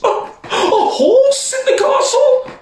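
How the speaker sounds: very high, laughing shriek; queen horrified by the horse, very high; screaming queen. Acted. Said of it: "the queen screamed".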